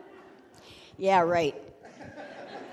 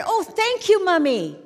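put-on high, sweet little child; mock-angelic child thanking Mommy; 8 semitones higher, 5 dB louder than usual. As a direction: play the grateful little kid, high and bright. Children answering their mother.

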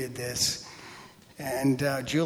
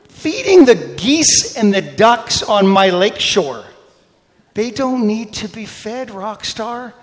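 loud, higher-pitched indignant retelling; worked-up comic complaint; irritated rant; 7 semitones higher, 6 dB louder than usual. Retold or imitated. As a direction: rant with mock outrage. Retelling his irritation at lady.

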